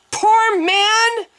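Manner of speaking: high, loud mocking echo; mocking shout. A narrator's reading. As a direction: throw her words back with scorn. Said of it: "Men shouted the words mockingly".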